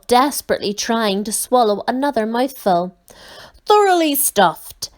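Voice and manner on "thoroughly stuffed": sighing. A narrator's reading. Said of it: "sighed the Count".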